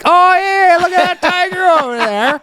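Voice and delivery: high-pitched, hammed-up Western accent; mock Western accent. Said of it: "and he's like".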